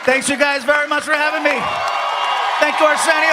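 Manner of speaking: high, projected farewell; shouted thanks. Real, not acted. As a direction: call out thanks to the crowd. Closing the set over applause.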